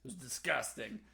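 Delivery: usual speaking voice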